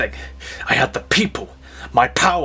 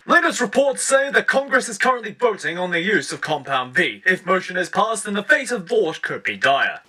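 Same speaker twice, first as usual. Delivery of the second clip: higher, crisp newsreader voice; news report; 5 semitones higher than usual. Acted. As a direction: read it like a TV bulletin.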